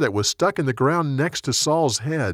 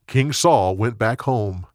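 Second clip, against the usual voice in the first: usual speaking voice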